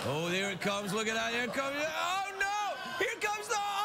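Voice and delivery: building mock alarm. Host watching the crash replay come up, mock alarm building. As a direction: start near normal, climb steadily to a high cry.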